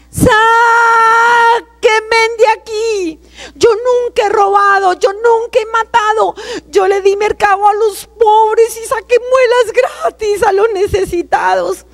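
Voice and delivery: high, crying scream for mercy; panicked, tearful cry pitched high; desperate screaming plea. Retold or imitated. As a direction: beg to be pulled out, voice cracking with terror. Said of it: "yo empecé a gritar".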